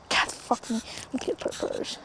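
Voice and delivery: hushed whisper so fish won't hear. Real, not acted. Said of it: "They can hear me, by the way".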